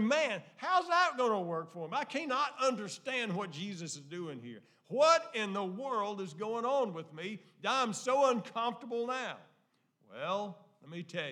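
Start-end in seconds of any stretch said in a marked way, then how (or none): 0.0-9.4 louder, higher complaining blind man. Acted. Preacher voicing the blind man's loud, higher complaint. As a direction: whine about the mud in your eyes.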